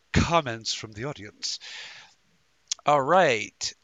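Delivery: usual speaking voice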